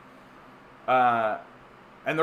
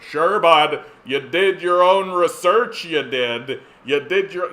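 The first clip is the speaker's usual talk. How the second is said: louder, slow sarcastic jab; 3 semitones higher, 7 dB louder, slower than usual. Acted. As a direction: lean into it loud and slow, mocking. Sarcastic retort at dismissive people.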